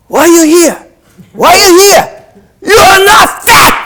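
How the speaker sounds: high, loud shout in a mock Russian accent; heavy Russian accent. Russian coach to the slim girls, imitated heavy Russian accent shout. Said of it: "in this heavy Russian accent".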